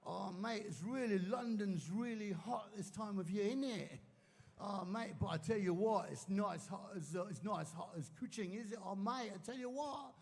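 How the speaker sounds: a lightly put-on British accent, chummy; hammed-up British mate accent; fake British accent. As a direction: show off a borrowed British accent after one week in London. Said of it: "I just got back from London".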